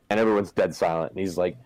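ordinary delivery

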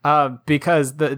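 normal talking voice

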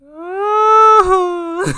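loud, high drawn-out mock wail; hammed-up howl of embarrassment. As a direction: wail like you're dying of shame. Acting out her embarrassed despair.

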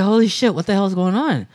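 high-pitched mock astonishment; replayed startled disbelief; retold shock. Retelling his shock at being left.

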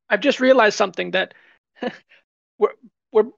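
ordinary delivery

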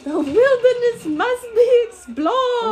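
high loud excited cartoon-kid shout; Up kid impression. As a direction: bellow it like an eager scout. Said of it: "what Donovan tells me".

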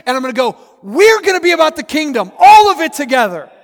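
loud, high, driving sermon delivery; impassioned pulpit shout; full-force preaching. Real, not acted. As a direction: preach it hard, building to a high, loud peak.

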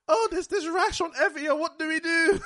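high, fast panicked caller. Retold or imitated. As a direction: squeal it like a worried friend. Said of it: "it's just like".

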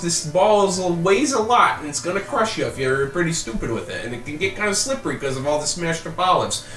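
brisk, kindly instructions to newcomers; village woman voice. Acted. Miriam voiced by game master.

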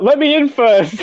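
high-pitched, hammed-up plea; mock pleading kid. Kid wanting in before the smack, mock pleading. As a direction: beg playfully in a high child's voice. Sibling begging to go first.

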